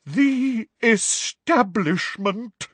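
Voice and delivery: very slow, high quavering pronouncement; dramatic, wavering. A narrator's reading. Professor names her persecutor, dramatic and wavering. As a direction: draw it out with theatrical dread. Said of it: "in a deep, dramatic, wavering voice".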